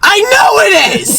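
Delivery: very high, drawn-out mock shriek; hammed-up shriek of exasperation; heated. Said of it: "it got very heated in this situation".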